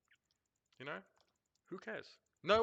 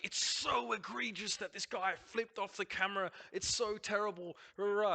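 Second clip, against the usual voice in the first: slow, higher, hammed-up pearl-clutching; mock outrage; 5 semitones higher than usual. Caster mocking officials who pretend a finger is scandalous, hammed-up outrage. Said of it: "we're gonna roll around and be like".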